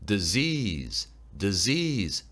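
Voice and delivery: "Disease" stressed on the second syllable, "sease"; slow "Disease", accent on the z sound. Shown as a demonstration. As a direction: lift and lengthen "sease" in "Disease". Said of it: "Z에 강세와 억양이 들어가고요".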